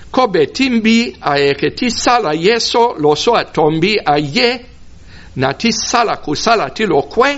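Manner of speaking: high-pitched Sango verse, ending up on "kwe"; tonal Sango reading. Shown as a demonstration. Preacher reads the verse in Sango, tonal, ending high. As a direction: lift "kwe" high at the end instead of dropping it. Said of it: "I ended that declarative sentence".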